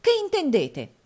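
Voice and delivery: high, loud startled question; shocked exclamation. A narrator's reading. Nicola leaping to his feet at the news, shocked exclamation. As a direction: cry out as if jumping up in alarm. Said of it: "esclamò Nicola balzando in piedi".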